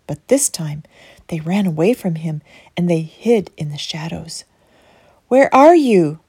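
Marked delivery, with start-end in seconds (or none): 5.3-6.2 calling out. Acted. God calls to hiding children.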